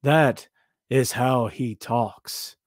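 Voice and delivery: slow, measured cadence with breaks mid-phrase; Keith Lee. Retold or imitated. Showing a viewer how the wrestler talks, slow deliberate cadence. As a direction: draw each phrase out deliberately. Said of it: "It's just his cadence".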